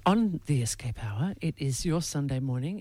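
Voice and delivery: soft, smooth radio host read. Acted. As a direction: ease into it like a Sunday morning DJ. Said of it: "God, that's a great radio voice you've got".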